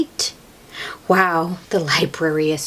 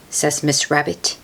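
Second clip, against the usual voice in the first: ordinary delivery, nothing marked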